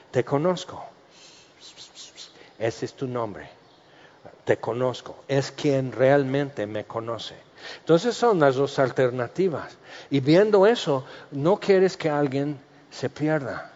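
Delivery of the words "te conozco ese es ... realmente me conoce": tender welcome. Retold or imitated.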